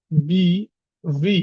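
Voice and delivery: ordinary delivery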